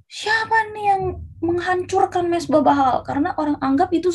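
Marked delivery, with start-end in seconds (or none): 0.1-3.0 high, indignant-sounding mock outcry; shocked crowd. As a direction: demand the culprit in a raised pitch. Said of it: "orang-orang kaget".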